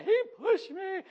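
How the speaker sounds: put-on high, whiny child wail; mock kid's crying squeal; screaming and crying. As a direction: imitate a bawling boy tattling. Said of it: "he's screaming and crying".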